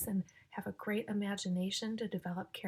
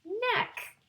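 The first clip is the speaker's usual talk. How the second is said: much higher, loud playful squeal; squealed punchline; 13 semitones higher, 6 dB louder than usual. Acted. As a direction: squeal the rhyme word for the kids. Punchline rhyme word squealed high.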